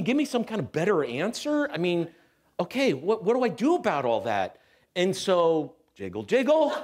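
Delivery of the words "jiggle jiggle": laughing. Real, not acted. Laughing at his own jiggle.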